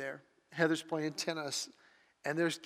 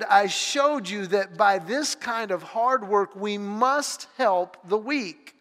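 louder and higher, a full-voiced sermon read of scripture; preaching; 4 semitones higher, 8 dB louder than usual. Real, not acted. Pastor reading Hebrews and Acts to the congregation, preaching loud. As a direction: proclaim it to the whole room.

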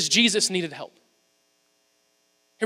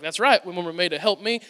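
lightly Southern man, low voice; low-pitched quote with a Southern twang; Southern accent; 4 semitones lower, 5 dB quieter than usual. Retold or imitated. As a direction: slip into a mild Southern accent. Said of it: "my voice went southern there".

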